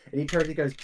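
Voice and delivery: ordinary talk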